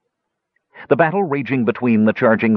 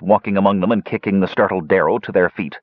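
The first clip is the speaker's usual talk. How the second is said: usual speaking voice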